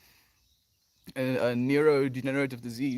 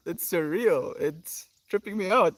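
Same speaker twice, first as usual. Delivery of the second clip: ordinary delivery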